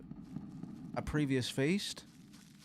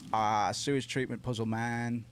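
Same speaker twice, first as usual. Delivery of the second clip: ordinary delivery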